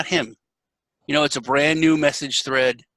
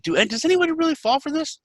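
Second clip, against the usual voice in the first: very high, disbelieving ask; can't believe anyone falls for it; incredulous question; 13 semitones higher, faster than usual. Retold or imitated. Asking the scammers if anyone really falls for it, very high. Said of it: "that's the first thing I ask them".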